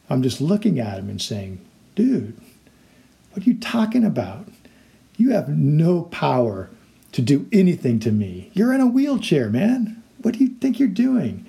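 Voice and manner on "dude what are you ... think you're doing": high-pitched, disbelieving pushback; incredulous retort. Acted. Former victim answering the powerless bully, acted incredulity. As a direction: talk back as if the threat is absurd.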